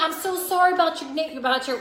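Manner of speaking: high, loud, hammed-up Southern sympathy; chatty Southern checker. Newcomer imitating a checker's sympathy, high loud Southern. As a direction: pitch up, gush the condolence. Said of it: "the checker would be talking".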